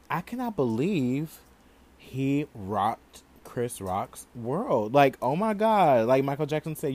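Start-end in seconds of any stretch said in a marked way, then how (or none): 0.1-4.9 slow disbelief. Real, not acted.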